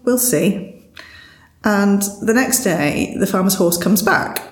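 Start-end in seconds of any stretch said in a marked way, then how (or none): 0.0-0.8 higher, slow, unbothered we'll see; drawn out like a wise old farmer who shrugs it off; lightly voiced serene farmer; calm farmer. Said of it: "the farmer just kind of goes".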